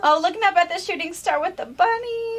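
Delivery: adoring coo. Real, not acted. Gushing over cute fabric print.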